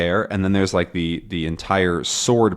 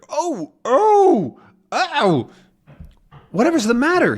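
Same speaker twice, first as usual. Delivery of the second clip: high yelps of pain; pained cries; 4 dB louder than usual. Acted. Haddock's beard caught in the helmet, high pained yelps. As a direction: cry out as it hurts.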